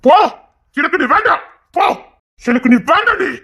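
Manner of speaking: high, loud rejection; angry shout. Acted. Man driving the woman away, shouting angrily. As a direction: yell at her to go.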